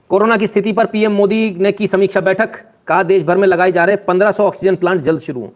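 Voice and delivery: booming headline delivery; news headline read. Acted. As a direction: announce it like a TV anchor. Reads a news headline aloud.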